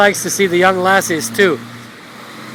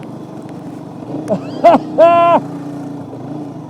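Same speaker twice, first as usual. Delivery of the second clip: breathy laugh; 7 semitones higher than usual. Real, not acted. Laughing after the ride.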